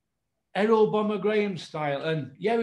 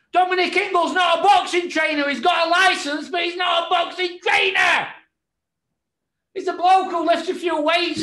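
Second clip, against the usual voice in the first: high, loud furious rant; angry rant; 9 semitones higher, 5 dB louder than usual. Real, not acted. Vlogger ranting at the trainer, loud and angry. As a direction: hammer each line home.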